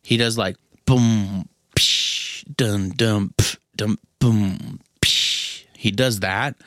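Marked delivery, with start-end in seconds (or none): 0.9-5.6 mouth-drum boom and psh, the psh just breathed; beatbox the crash on two; drum beat imitation. Fan imitating [name]'s drumming with mouth sounds. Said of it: "he does this signature thing".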